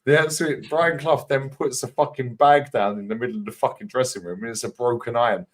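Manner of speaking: usual speaking voice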